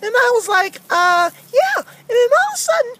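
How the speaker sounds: high-pitched, worked-up character voice; complaining cartoon character; rowdy aggrieved Francis. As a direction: tattle indignantly in a high puppet voice. Francis tells how she was tripped.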